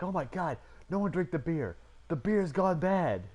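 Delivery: high, rising alarm, last words drawn out slow; panicked nerd. Retold or imitated. Nerd at a party warning everyone off the beer, high and slow. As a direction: sound the alarm about the beer like it's poison.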